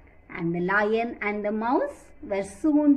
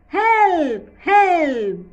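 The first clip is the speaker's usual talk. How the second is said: loud, higher cry of Help!; shouting for help; 5 semitones higher, 7 dB louder than usual. Acted. Trapped lion shouts for help from the hunter's net, loud. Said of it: "He roared and he shouted for help".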